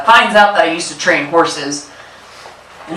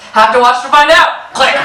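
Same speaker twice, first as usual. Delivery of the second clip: higher, louder teasing with a rising end; teasing reply, laughing; 7 semitones higher, 4 dB louder than usual. Retold or imitated. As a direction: repeat the tease to Dad, laughing, rising on out. Same teasing reply again.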